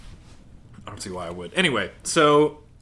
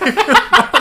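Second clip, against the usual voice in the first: laughing as Marley; 14 semitones higher, 9 dB louder than usual. Retold or imitated. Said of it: "Marley's like".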